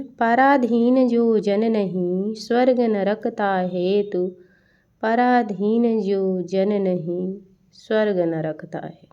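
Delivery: slow, stretched verse delivery; verse recitation. Acted. Teacher quoting a verse for students, slow recitation. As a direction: declaim it slowly, lengthening the syllables.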